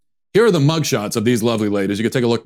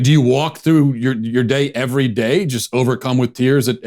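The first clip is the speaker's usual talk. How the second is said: usual speaking voice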